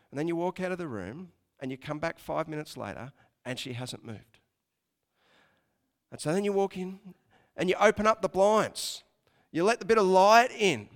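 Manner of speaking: usual speaking voice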